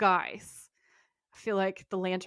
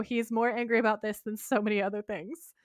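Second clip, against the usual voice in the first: ordinary delivery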